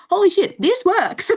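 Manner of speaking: high, loud burst of amazed triumph; elated disbelief. Retold or imitated. Client reliving her milestone for the host, elated disbelief.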